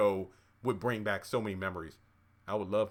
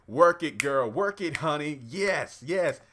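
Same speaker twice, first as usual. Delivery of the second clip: cheering; 4 semitones higher, 5 dB louder, slower than usual. Real, not acted. Cheering Alyssa's dance.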